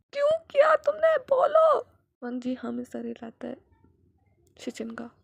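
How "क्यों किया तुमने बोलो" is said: very high, very loud scream; screaming demand. Acted. Brother screaming at the younger one to answer, full force.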